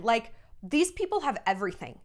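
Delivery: ordinary delivery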